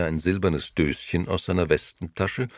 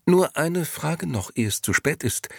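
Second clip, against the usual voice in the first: interjecting lady; 12 semitones higher than usual. A narrator's reading. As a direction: cut in politely but insistently, pitched up. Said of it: "mischte sich nun die zweite Dame dazwischen".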